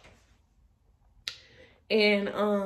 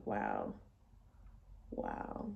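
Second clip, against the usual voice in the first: soft, drained, almost whispered; hushed disbelief after being fired; stunned wow; 15 dB quieter than usual. Retold or imitated. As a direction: let the shock empty your voice. Crushed by the firing.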